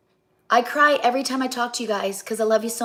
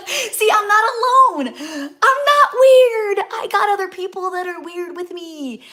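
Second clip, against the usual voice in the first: much higher and louder, slow, near tears; tearful glee; 9 semitones higher, 7 dB louder, slower than usual. Real, not acted. Celebrating fellow jingle singers, high and tearful. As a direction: cry out with happy relief.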